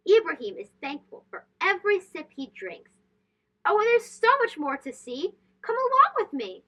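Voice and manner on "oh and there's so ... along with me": bright, higher sing-song call to come along; excited invitation. A narrator's reading. Narrator calling young listeners onward, bright and excited. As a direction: lift it up like beckoning a child to follow.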